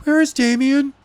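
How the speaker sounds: a hint of Kelly's worry; Kelly voiced. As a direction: ask anxiously for the missing boy. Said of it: "asked Kelly".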